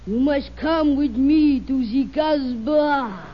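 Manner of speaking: slow, sultry Casbah seducer voice; romantic film lover.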